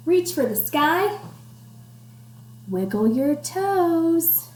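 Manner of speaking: slow rhythmic rhyme reading; sing-song read-aloud. Acted. Teacher reading rhyme to class, slow sing-song. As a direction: bounce the rhyme for kids. Reading picture book to children.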